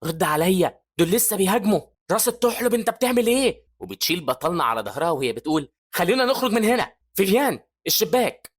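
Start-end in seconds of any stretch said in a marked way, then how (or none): 0.0-3.6 raised, high, urgent scolding. Acted. Evie to dazed Andreas under zombie attack, urgent scolding. 6.0-8.5 high, raised urgent order; urgent command. Acted. Evie ordering Vivian toward the window, urgent command.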